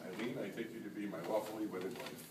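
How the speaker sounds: saying wedding vows. Real, not acted. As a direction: echo the vow back softly. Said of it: "repeat after me".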